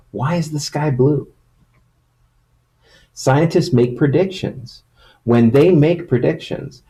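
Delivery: storybook reading voice; reading to children. Acted. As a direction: read it like a picture book to kids. Reads picture book to children.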